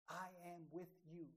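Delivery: softly spoken reassurance; hushed, steady quote of a promise; quiet promise. Acted. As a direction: give the promise gently, well under preaching volume. Preacher quotes promise, then repeats it.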